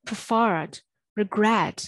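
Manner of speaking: "preferred" and "regret" leaning hard on FERRED and GRET; pre-FERRED, re-GRET, drawn out on the second syllable; second-syllable stress. Shown as a demonstration. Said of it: "ဒုတိယစာလုံးမှာ stress ကထည့်".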